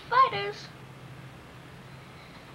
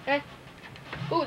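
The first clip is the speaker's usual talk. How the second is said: ordinary talk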